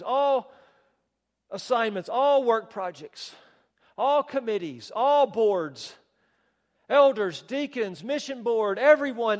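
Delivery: fiery pulpit emphasis; preaching at full force. Acted. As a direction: pound out each item like a drumbeat.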